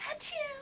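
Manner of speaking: high, soft little achoo; a dainty high sneeze, held back; Japanese sneeze. Shown as a demonstration. Showing the Japanese sneeze, a small high polite achoo. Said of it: "Now, the Japanese sneeze".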